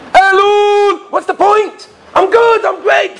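very high-pitched mock insistence; complacent protest. Congregants insisting nothing needs fixing, mock-protesting. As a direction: brush off advice in a squeal. Acting out complacent people dismissing Elul.

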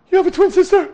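very high, very loud disbelief; shocked question. A narrator's reading. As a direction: blurt it out in amazement. Hero hears of twin sister.

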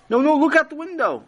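high, excited protest; urgent insistence. A narrator's reading. Chaim telling disbelieving Tati to look, urgent.

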